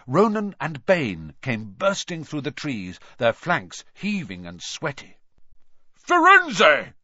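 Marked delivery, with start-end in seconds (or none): 5.9-6.9 much higher, roaring call of a name; thundering call. A narrator's reading. Bane confronts Firenze across the clearing, thundering roar. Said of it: "Bane thundered".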